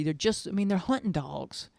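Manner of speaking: ordinary talk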